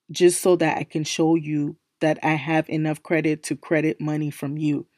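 ordinary talk

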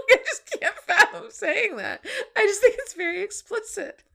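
laughing. Real, not acted. Laughing, disbelief at line.

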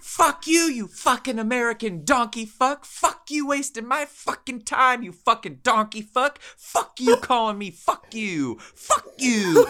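high, mock screaming tirade in the fake accent; furious accented rant. The scammer raging at the caller for wasting his time, in a mock furious tirade. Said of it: "I heard his spirit break".

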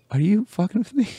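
high, disbelieving mock cry; incredulous customers. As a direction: play the stunned customers who can't believe it. Customers see him walk off.

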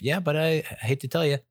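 lightly voiced mother, reluctantly insisting; imitating mother. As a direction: play mom breaking bad news. Voices his mother in retold argument.

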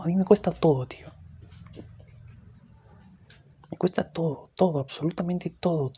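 loud weary venting, pushing hard on nada and todo; loud fed-up complaint about life; loud despairing rant. Real, not acted.

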